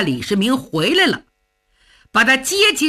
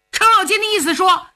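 usual speaking voice

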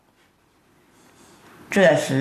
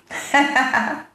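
short high stage chuckle; storyteller's placating laugh; acted laugh; 5 semitones higher than usual. A narrator's reading. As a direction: laugh it off in a quick high run. Mediator laughs before soothing him.

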